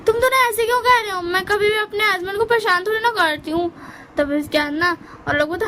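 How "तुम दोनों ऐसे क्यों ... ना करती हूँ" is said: high, whiny mock protest. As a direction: play the sister pleading innocent, voice up high. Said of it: "ये अली बोलती". (दीदी की ऊँची, रोनी-सी शिकायत)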